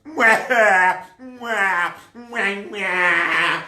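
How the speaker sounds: hammed-up high boo-hoo sobs; mock crying. Townspeople hearing the threat, hammed-up sobbing. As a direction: pretend to wail like a child. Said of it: "people started crying".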